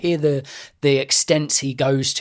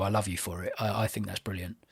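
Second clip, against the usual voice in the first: affectionate whisper; 3 semitones lower, 10 dB quieter than usual. Real, not acted. Fond praise of co-host.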